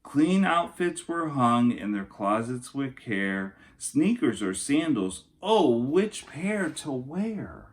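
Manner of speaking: storybook narration for kindergartners; lilting rhyming picture-book voice; reading to children. Acted. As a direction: read it like story time for little kids. Reads picture book aloud.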